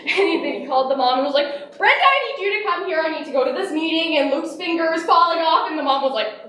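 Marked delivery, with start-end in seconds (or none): 1.8-5.7 raised pitch, rushed retold urgency; urgent phone plea. Dad calling mom about the injured finger, mock urgent. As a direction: hurry through it like a flustered husband.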